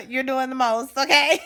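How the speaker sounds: laughing through it. Retold or imitated. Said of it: "She'll be like".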